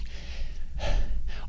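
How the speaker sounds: long, voiceless winded exhale; heavy breathing. Retold or imitated. Showing a friend how the summit feels, heavy breathing. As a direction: breathe out like you just finished climbing. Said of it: "breathing heavy".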